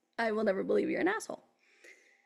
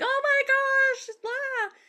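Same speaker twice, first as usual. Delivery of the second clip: mock gasp of dismay, much higher and louder; dismayed crowd; 13 semitones higher, 6 dB louder, much slower than usual. Shocked fans at the fallen sign, hammed-up high dismay.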